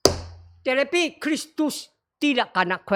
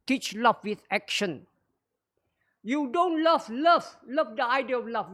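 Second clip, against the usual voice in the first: ordinary talk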